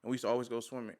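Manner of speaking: usual speaking voice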